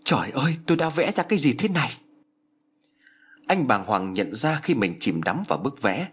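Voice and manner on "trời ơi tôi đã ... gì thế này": higher-pitched dismay, understated scream; a touch of shock, voice lifting higher; mild horrified cry. A narrator's reading. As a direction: narrate a cry of horror without really shouting. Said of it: "Thiệp kinh hãi thét lên".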